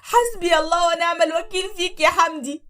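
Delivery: loud, high sobbing curse; crying curse. Acted. Wife crying her curse at Hamdi. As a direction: cry it at Hamdi.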